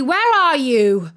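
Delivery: loud, slow call across the house; shouting. A narrator's reading. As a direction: mum hunting for her daughter. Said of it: "shouted Mum".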